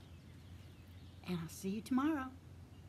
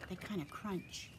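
whisper; 7 dB quieter than usual. A narrator's reading. Describing soft footstep sounds.

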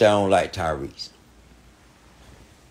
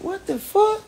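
mock disbelief, squeaked way up high; shocked squeal. Exasperated outburst about Tyrese.

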